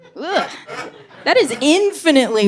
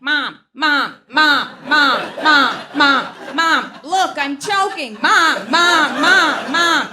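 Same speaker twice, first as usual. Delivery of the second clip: higher, whining repeated calls; nagging toddler; 6 semitones higher, slower than usual. Retold or imitated. Toddler calling his mom nonstop, high whine. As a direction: pester like a small child wanting attention. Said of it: "the kid in the background is like".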